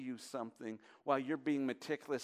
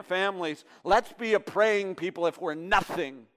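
louder, higher, pleading sermon voice; full-force preaching; 6 semitones higher, 12 dB louder than usual. Acted. Pastor urging his church to pray, preached at full force. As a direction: drive it home to the congregation, pleading.

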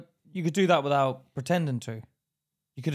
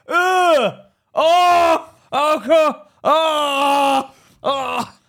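loud, high, drawn-out groans of pain; mock agony wails; 11 semitones higher, 10 dB louder, much slower than usual. Friend imagining Batman shot in the jaw, mock agony wails. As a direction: wail as if badly wounded, hammed up.

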